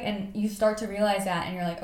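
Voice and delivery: ordinary talk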